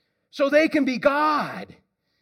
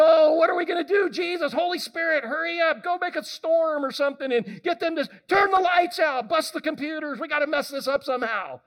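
mock frantic God; 6 semitones higher than usual. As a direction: ham up God barking panicked orders. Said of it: "Is he going".

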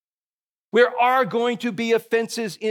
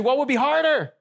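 ordinary delivery

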